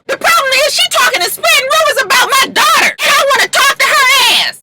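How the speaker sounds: very high, fast shouting rant; mock furious yell. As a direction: scream about the rumors on her daughter. Lyrica yelling about rumors.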